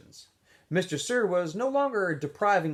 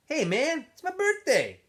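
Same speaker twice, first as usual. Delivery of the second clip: much higher, louder whiny protest; Zigzag protesting; 13 semitones higher, 3 dB louder than usual. A narrator's reading. Zigzag to Squid in the breakfast line, high whiny protest. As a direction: squeal it like an indignant teen.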